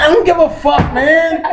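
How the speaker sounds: high, loud mock yell; shouted brush-off. Narrator yelling indifference at coworker's dramatic news, high mock yell. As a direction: fling it back at him dismissively.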